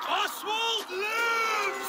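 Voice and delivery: very high, slow drawn-out cry of triumph; stretched victory call; triumphant shout. Acted. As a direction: proclaim it to the whole field. Proclaiming Oswald alive to all.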